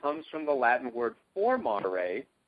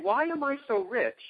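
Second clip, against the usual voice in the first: wondering; 8 semitones higher than usual. Retold or imitated. Question repeated as example.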